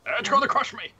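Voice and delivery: high, loud yelp of alarm; panicked cry. Acted. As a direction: cry out as if about to be crushed. Dangling character cries out in panic.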